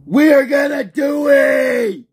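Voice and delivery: high, loud, stretched-out battle cry; rallying shout. Acted. Player starting a rampage, loud high battle cry. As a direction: yell it slow like a war cry.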